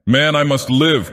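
high-pitched, passionate protest; passionate cry. A narrator's reading. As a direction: cry out in desperate appeal, pitch leaping up. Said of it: "cried the fisherman as passionately".

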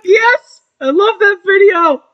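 high loud excited cry; excited shout. Real, not acted. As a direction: yell it with joy.

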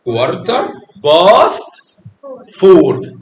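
slow, drawn-out كوارتر باست rising on باست; teacher demonstrates the time slowly; slow model. Shown as a demonstration. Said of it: "عايز اقولها بالطريقة دي".

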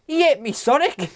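put-on high, excited Huddersfield accent. Imitating the Doctor's Huddersfield line, high and loud. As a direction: mimic Jodie Whittaker's Yorkshire yelp. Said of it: "that Huddersfield accent".

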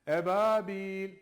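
"Ebabil" with the b lengthened and a little thick; drawn-out b. Shown as a demonstration. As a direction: stretch the b in "Ebabil". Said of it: "B'yi uzatıyoruz". ("Ebabil" B uzatılarak)

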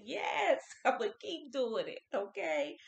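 loud, high, laughing yes; excited and a bit giddy; fired up. Real, not acted. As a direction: pump yourself up, laughing. Fired-up self-affirmation.

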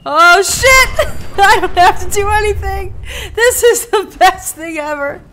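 high, loud words through laughter; laughing hysterically. Real, not acted. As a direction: talk while cracking up. Laughing at companion's rampage.

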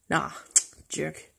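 ordinary delivery

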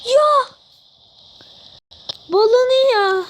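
frustrated shout. Real, not acted. Reacting to opponent's play.

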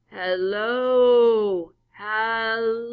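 loud distant call. Acted. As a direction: call out to the car from afar. Hitchhiker calling from afar.

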